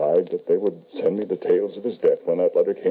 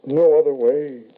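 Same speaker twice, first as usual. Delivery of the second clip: louder, high cry of despair; desperate outburst; 8 semitones higher, 5 dB louder than usual. Acted. Grieving father refuses the medium's advice, loud and desperate. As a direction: throw the question out in anguish.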